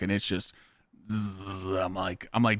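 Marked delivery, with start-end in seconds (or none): 1.1-1.6 low-volume imitated buzzing; tattoo machine buzz. Client retelling his elbow session, imitating the machine. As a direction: buzz softly like a tattoo needle.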